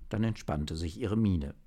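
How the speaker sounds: usual speaking voice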